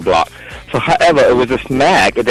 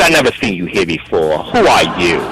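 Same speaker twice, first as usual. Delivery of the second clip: lower-pitched, deliberate interrogation; imitated stern cop; 5 semitones lower than usual. Cop questioning a newcomer, low and stern. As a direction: drop the pitch and confront a stranger. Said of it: "He talked to me just like that".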